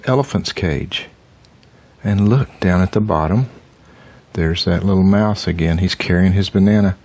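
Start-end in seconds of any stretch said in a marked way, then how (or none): none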